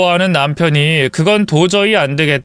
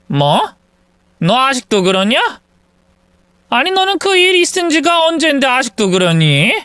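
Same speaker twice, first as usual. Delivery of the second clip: put-on higher, exasperated older mother; mild mock scolding from an old mother; scolding mother-in-law; 5 semitones higher than usual. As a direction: nag your grown son in disbelief.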